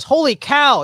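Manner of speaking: loud, high exclaimed holy cow; retold yell. Brother at church youth trip, loud high exclamation. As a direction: blurt it out like an excited boy. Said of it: "her brother yells".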